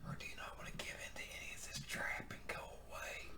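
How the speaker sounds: stage whisper. Pretend. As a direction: whisper right into the mic. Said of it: "I'm gonna stage whisper next to the mic".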